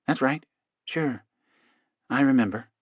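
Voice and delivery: reply softly from inside a trance; entranced patient. A narrator's reading. Hypnotized man reassuring his doctor, softly entranced.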